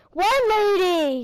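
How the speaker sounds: high, loud, drawn-out cry; retold scream. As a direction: blurt it out loudly, stretching the words. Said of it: "He screamed it".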